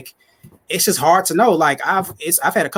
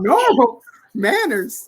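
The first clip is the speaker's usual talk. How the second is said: teasing; 9 semitones higher, much slower than usual. Real, not acted. Echoing friend, teasing.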